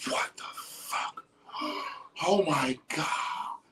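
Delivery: gasps then a slow whispered what the fuck; breathless whisper of shock between gasps; stunned gasping whisper. Retold or imitated. Describing the first moment on Bufo, gasping awed whisper. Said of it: "It's like by the time you go".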